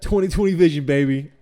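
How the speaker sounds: loud, fast, cocky brag; playful boast. Pretend. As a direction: show off like a cheeky hype man.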